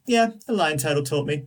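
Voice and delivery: offhand, breezy shrug of a line; casual. Retold or imitated. Aang casually telling friends about his new power, offhand. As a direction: toss it off like it's nothing. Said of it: "the way he just casually goes".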